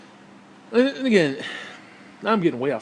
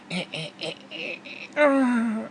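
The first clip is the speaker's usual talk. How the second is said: mock feeble straining noises; imitated grunting; 7 semitones higher, slower than usual. Imitating grunts of lazy performers.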